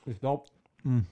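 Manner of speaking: talking with food in mouth; chewing while talking. Real, not acted. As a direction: say it mid-bite, chewing. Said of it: "Ich esse gerade, sorry".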